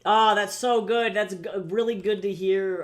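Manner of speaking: thrilled by a viewer's compliment; delighted. Real, not acted. Reacting delighted to chat compliment.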